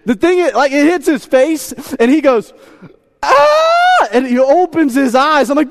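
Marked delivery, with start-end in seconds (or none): 3.2-4.1 put-on high shrieking baby yelp; a sudden high yelp like a startled baby; baby's startled cry. Baby shocked awake at the changing table, high yelp.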